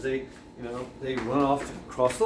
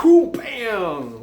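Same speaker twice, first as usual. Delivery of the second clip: mock splash; 8 semitones higher, 8 dB louder than usual. Falling into the water.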